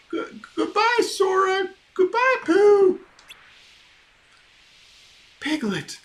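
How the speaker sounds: very high squeaky goodbye; a small timid pig waving off; Piglet voice. Acted. Piglet saying goodbye to Sora and Pooh, squeaky. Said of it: "character farewells".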